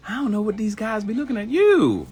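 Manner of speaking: high, loud hype voice; hype encouragement. Acted. Hyping up women who doubt their looks, high and loud.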